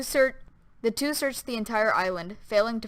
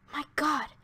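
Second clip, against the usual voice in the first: exclaiming; 5 semitones higher, 5 dB quieter than usual. A narrator's reading. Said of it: "exclaimed Mister Bohr".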